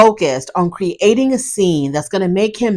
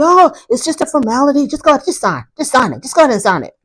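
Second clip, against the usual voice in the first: higher-pitched imitation of someone pressing; lightly acted brush-off, urging her along; mild mock coaxing; 7 semitones higher, faster than usual. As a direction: retell his pushy urging, pitched up at first. Retold husband pressing her to sign.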